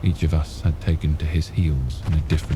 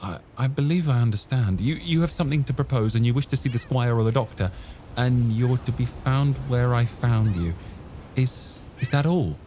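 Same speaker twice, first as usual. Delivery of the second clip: put-on higher boyish voice, earnest; the lad pressing to get back to his friends; youthful, keen voice; young Jim; 6 semitones higher than usual.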